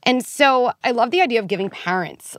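ordinary talk, nothing marked

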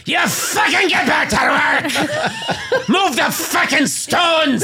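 high, slow yelling boss, breaking into laughs; angry overseer shout. Retold or imitated. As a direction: scream at him to get back to work.

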